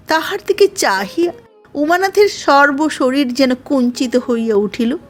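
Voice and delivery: ordinary delivery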